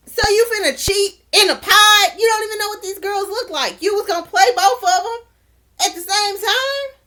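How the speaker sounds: high-pitched, indignant disbelief; incredulous scolding. Pretend. Recapper challenging Shane about cheating in a pod, high and incredulous. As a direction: question him as if you cannot believe it.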